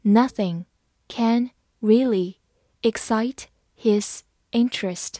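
slow, phrase by phrase; careful chunked reading; slow read-along. Shown as a demonstration. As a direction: read it slowly in chunks for students. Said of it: "慢慢来读".

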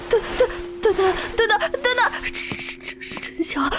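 panicked stammer rising to cries; begging for his life; terrified begging. Acted. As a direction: candidate begs examiner not to shoot, terrified. Gun pointed at him.

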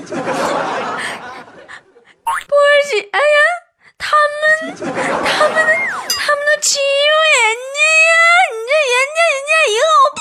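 high, whimpering complaint, half crying; whiny crying Tuotuo. Acted. Whining to sister Bo about being bullied, high and teary. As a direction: play a sulky grown man whining like a child.

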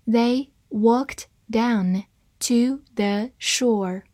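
slow, word by word; deliberate, separated teaching pace; slow reading. Shown as a demonstration. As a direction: space out each word for learners. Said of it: "我们慢慢来读".

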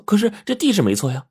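high-pitched, puzzled insistence; insistent protest. A narrator's reading. Xiaoqin showing the slip to the woman, insistent. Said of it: "大声地说".

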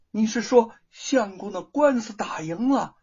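a joyful question with a catch of tears in it; tearful joy. A narrator's reading. Wife asking the monkey about the lawsuit, tearfully overjoyed. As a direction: ask it almost crying with happiness. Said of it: "玉凤惊喜的说".

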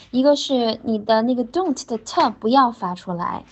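ordinary delivery

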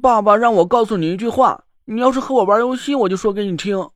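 put-on child voice. Mud doll child speaks.

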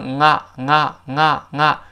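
repeated "嗯啊" as "nga"; velar nasal link. Shown as a demonstration. Teacher drilling the sound, "嗯啊" as linked nga. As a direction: start "嗯啊" at the back of the tongue. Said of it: "是个舌根音".

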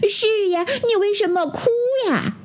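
very high, tiny ant voice; high-pitched, curious small creature; little ant. A narrator's reading. Ant asks the bear why it cries, high tiny voice.

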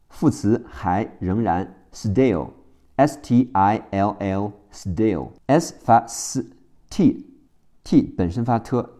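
normal talking voice

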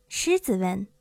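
ordinary delivery

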